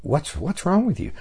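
a hint of a puzzled grandfather; lightly voiced grandfather, asking gently. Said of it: "grandfather's looking at you going".

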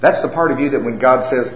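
ordinary delivery

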